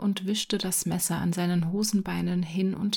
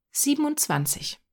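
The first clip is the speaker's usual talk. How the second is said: confident answer; 8 semitones higher than usual. A narrator's reading. Boy answers the sum confidently.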